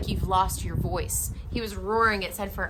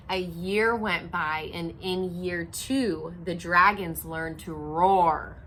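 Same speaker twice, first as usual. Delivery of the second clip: story narration; 3 semitones lower, 5 dB louder, slower than usual. A narrator's reading. Reading picture book aloud.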